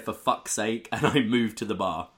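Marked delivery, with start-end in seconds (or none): none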